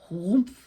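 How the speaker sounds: sudden, loud storybook whoomp; big narrated impact noise. A narrator's reading. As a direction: imitate a body plunging into snow. Second girl jumps down hole.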